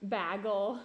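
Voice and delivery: "bagel" said loud as BAG-ul; regional bagel pronunciation. Retold or imitated. Mocking how people say it, "bagel" as BAG-ul. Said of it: "the way people say bagel".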